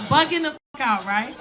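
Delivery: usual speaking voice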